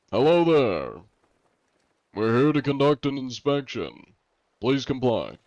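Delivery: inspector hailing crew. Pretend. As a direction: call out like a mock inspector demanding compliance.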